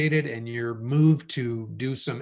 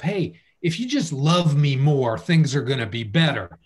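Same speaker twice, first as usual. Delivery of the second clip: pleading partner; 3 semitones higher, 7 dB louder than usual. Pretend. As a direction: beg to be loved more. Imagined partner pleading in couple.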